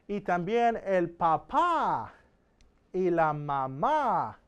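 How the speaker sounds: slow, careful reading with stress on papá and mamá; slow modelling. Shown as a demonstration. Teacher reads family words slowly for students to copy. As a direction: draw the words out for the class. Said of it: "There's that accent mark".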